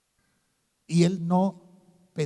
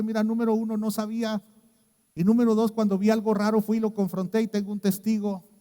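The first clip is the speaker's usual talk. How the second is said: louder, higher firm answer; firm reply; 7 semitones higher, 7 dB louder than usual. Retold or imitated. Preacher retells answering the angry pastor, firm and loud. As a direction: stand your ground and push back. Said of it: "contestarle muy firme".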